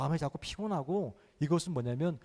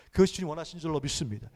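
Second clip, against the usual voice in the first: ordinary talk, nothing marked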